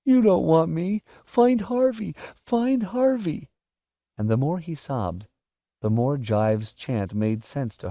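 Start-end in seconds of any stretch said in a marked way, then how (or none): 0.0-3.5 very high, snivelling wail; desperate sobbing boy; whining in tears. Acted. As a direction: whine it through snot and tears. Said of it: "still Wendell whined, all snot and tears".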